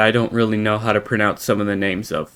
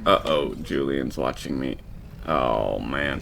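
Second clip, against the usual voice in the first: caught-out hush; 8 semitones lower, 8 dB quieter than usual. Pretend. Caught slacking by boss.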